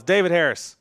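loud, high announcing the name; big reveal. Shown as a demonstration. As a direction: announce it like a winner. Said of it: "I love a dramatic pause".

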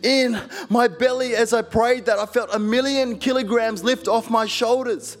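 high and loud, ringing testimony; full-force preaching. Acted. Preacher to congregation, testifying at full force. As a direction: preach it out to the whole hall.

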